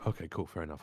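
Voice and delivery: quietly hurt, understated; a bit somber. Shown as a demonstration. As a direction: read it a little hurt and subdued. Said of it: "You just go a bit".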